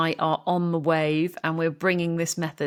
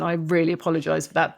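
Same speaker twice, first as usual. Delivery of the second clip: normal talking voice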